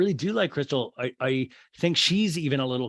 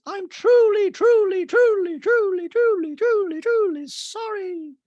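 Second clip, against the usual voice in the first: very high, loud mimicry, dragging out at the end; hammed-up apology; 7 dB louder, slower than usual. Recapper mimics castmate apologising to Crystal, high and exaggerated. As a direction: pound out each repeated word in a high mock voice. Retold over-the-top apology.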